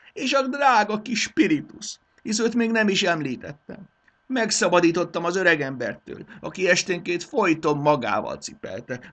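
high, loud, fretful royal voice; a spoiled king wailing over his treasures; distraught king. Acted. The king's lament continues.

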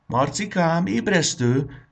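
high, loud, drawn-out wake-up call; calling from the kitchen. Acted. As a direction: call the boy awake from afar. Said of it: "durzsolt a nagymama a konyhából".

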